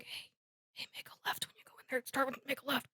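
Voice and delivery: hushed, unvoiced, conspiratorial whisper; secret whisper. Moody sneaking Harry a tip before the maze, whispered. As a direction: lean in and whisper the tip so nobody hears.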